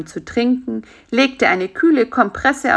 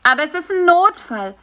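high, louder insistent plea; urgent protest; 11 semitones higher, 5 dB louder than usual. A narrator's reading. Spider insists to the doctor it is urgent, pleading protest. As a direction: protest urgently against being turned away.

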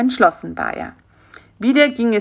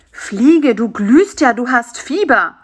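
loud, higher cry of alarm; alarmed exclamation; 5 semitones higher, 7 dB louder than usual. A narrator's reading. Karl-Heinz discovers his friend's fever, alarmed exclamation. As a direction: exclaim in shocked concern.

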